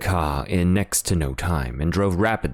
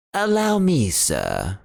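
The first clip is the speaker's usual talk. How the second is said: higher butler voice, eager to help; obliging butler; 7 semitones higher, slower than usual. Acted. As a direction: step in politely. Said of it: "said Parker".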